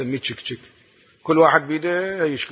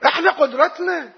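doubter's objection; 9 semitones higher than usual. Retold or imitated. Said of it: "ممكن واحد يستشكل".

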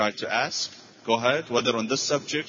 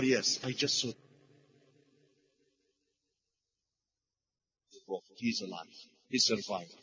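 hushed reassurance; 2 semitones lower, 8 dB quieter than usual. Retold or imitated. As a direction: drop right down to comfort her. Gentle answer to grieving woman.

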